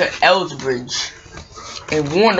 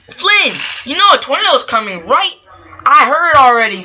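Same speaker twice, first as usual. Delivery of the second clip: urgent exclaiming; 7 semitones higher, 6 dB louder than usual. Acted. Urgent exchange about the tornado.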